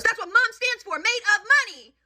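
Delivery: put-on very high, sassy child's retort; bratty comeback. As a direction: mimic a mouthy kid talking back to mom. Girl talking back, acted.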